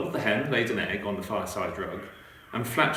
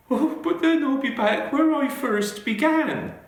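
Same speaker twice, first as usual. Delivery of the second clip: put-on very high, loud squawking old lady voice; frantic old lady; 7 dB louder than usual. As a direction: cry out in despair at the crowded house.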